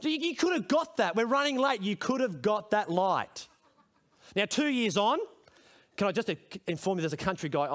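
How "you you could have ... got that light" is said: put-on higher pleading child's voice; a hint of an impatient kid in the back seat; imitating his child urging him through the light; mock kid complaining. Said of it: "my backseat driver said".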